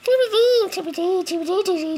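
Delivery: very high squeaky chipmunk chirps. Pretend. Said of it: "Can you do it more in a chipmunk voice?".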